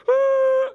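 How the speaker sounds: exaggerated bawling like a hurt kid; mock ugly cry. Kid with a cut head, big hammed-up ugly-cry wail. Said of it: "you gotta do the ugly cry".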